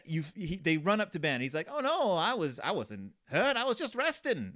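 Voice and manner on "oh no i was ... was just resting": high-pitched, hammed-up farmer character; Farmer Ben voice. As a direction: protest in a put-on farmer voice. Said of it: "That's my Farmer Ben voice".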